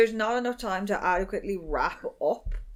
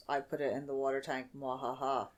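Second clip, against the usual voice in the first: low, quiet evil gloat; mad scientist; 5 semitones lower, 9 dB quieter than usual. Retold or imitated. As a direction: mutter it like a cartoon villain. Villain voicing poisoning plan.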